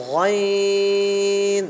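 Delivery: গইন long, in a thick voice; thick ghain. Shown as a demonstration. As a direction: read গইন with a thicker, heavier sound. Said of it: "একটু মোটা আওয়াজ দিয়ে পড়বো".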